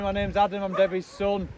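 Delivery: ordinary delivery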